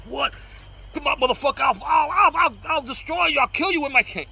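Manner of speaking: high, loud shouted threats in a wrong-way demo; a mock furious outburst at a subway attacker; aggressive threats. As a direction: yell it like you're spoiling for a fight. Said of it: "you're like".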